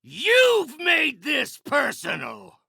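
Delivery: menacing villain's shout; vengeful rage. Acted. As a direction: shout it as a personal grudge. Villain raging in battle.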